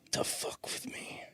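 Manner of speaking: hushed mock-sinister whisper. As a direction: breathe it slowly, barely voiced. Teasing whisper in banter.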